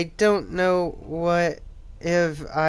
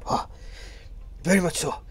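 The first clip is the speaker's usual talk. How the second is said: normal talking voice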